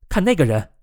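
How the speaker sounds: a mild narrated shout; calling out loudly. A narrator's reading. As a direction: cry out in alarm, held back for storytelling. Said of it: "艾莫里大声叫道".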